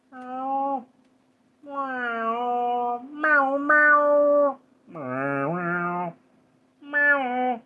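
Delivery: high, put-on meows like a cat; cat meowing. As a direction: meow high and cute like a kitten. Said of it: "can you do a cat impression?".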